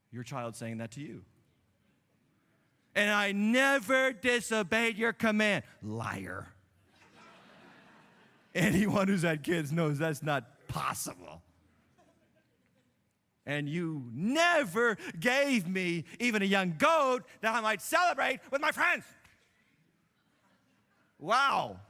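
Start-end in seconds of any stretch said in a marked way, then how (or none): 2.9-5.6 louder, slow, NEVER leaned on hard; indignant son. Acted. Preacher voicing the bitter older brother, slow and indignant. 13.4-19.0 loud, slow, aggrieved; bitter complaint. Acted. Preacher as the older brother griping to his father, loud and slow. Preacher voicing the resentful son.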